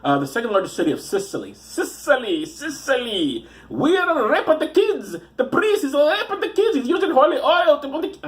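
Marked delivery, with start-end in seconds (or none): 1.5-3.5 high, hammed-up mock Italian accent; cartoonish Italian-ish accent; unplaceable accent. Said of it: "I don't know what that accent is". 3.7-8.3 high, hammed-up mock Italian accent; cartoonish Italian-ish accent, half laughing; unplaceable accent. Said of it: "I don't know what that accent is".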